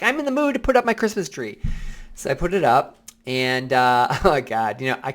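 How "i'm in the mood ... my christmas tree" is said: higher, fast and eager, quoting himself; a quick lift of excitement in the quote; bright self-quote. Retold or imitated. Quoting his past self, suddenly eager to decorate. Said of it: "I'm like".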